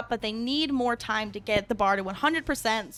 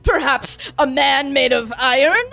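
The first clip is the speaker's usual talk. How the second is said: loud, slow teasing hint; slow, loud movie-trailer tease; leaning into the big reveal; mock dramatic tease; 4 semitones higher, 9 dB louder, slower than usual. Teasing the obvious Iron Man reveal.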